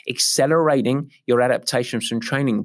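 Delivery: usual speaking voice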